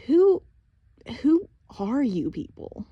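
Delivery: high, loud cry on who, then bewildered; bewildered demand. A narrator's reading. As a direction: demand to know who they are. Bewildered girl demands answers.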